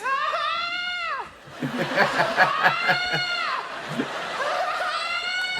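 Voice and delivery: long high shrieking screech; imitated bird scream. Comedian imitating a night bird in the dark woods, high screech. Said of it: "what bird sounds like a child screaming".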